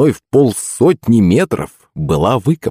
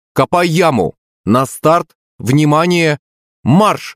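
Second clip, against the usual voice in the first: commanding shout; 9 semitones higher, slower than usual. Acted. Commanding pet to start digging.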